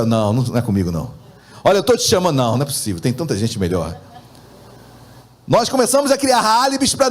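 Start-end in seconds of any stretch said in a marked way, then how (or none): 0.0-2.4 low, reluctant brush-off; reluctant refusal. Acted. Preacher voices someone turning down God's call, low reluctant refusal. Acts out reluctant reply to God. 2.4-4.0 low, reluctant brush-off; reluctant refusal. Acted. Preacher voices someone turning down God's call, low reluctant refusal. Acts out reluctant reply to God.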